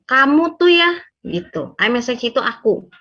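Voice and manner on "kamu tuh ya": louder, higher accusing jab. Shown as a demonstration. Partner blaming the other with "kamu", accusing. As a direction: point the blame at them, pitch raised. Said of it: "bahasanya bukan menunjuk".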